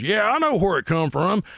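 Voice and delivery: put-on high, slow drunken holler; old drunk man. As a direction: mimic a barroom drunk butting in. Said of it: "This old drunk man shooting pool".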